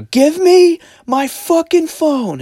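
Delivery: slow, high, loud shriek; drawn-out mock shriek of outrage; imitated screaming. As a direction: mimic a woman screaming a demand. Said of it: "they're just screaming at each other".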